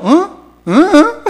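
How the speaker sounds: rising, high Gyeongsang-dialect 응 with a laugh; laughing dialect question; playful Gyeongsang 응. Retold or imitated. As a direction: tease the congregation with dialect.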